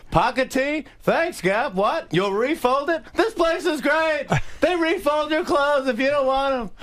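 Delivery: high-pitched, incredulous comic impression; Jerry Seinfeld yelling. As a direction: yell in amazement, high and stretched at the peaks. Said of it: "we're just yelling back and forth".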